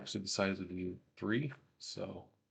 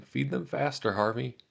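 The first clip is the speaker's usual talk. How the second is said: raised, higher urging voice, short of a full yell; 5 semitones higher, 6 dB louder than usual. Retold or imitated. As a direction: read it as a mild yell to a comrade. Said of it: "I'm yelling at him".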